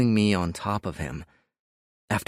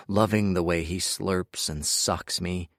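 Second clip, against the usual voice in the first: usual speaking voice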